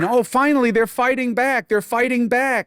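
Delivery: high, mocking cheer from the lefties; hammed-up gleeful crowd voice. Mocking the cheering lefties, high and gleeful. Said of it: "That's why they're all cheering".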